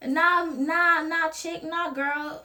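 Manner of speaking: high, sassy "nah" to a bad lover; 1 dismissive brush-off. Telling off someone who loves you wrong, sassy dismissal. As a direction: wave them off with attitude. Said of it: "be like".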